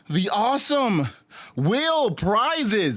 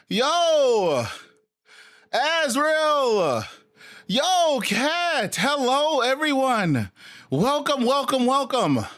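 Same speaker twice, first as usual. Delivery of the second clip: excited greeting; 5 semitones higher, slower than usual. Real, not acted. Streamer welcoming viewers by name, excited. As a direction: greet everyone with energy.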